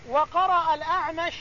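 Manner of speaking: ordinary delivery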